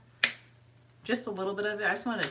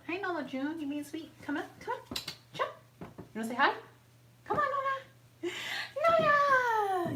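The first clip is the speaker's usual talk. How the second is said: high, sing-out call to a pet, rising to a loud "Nola!"; coaxing a dog; 11 semitones higher, 3 dB louder than usual. Real, not acted. Calling and coaxing her dog.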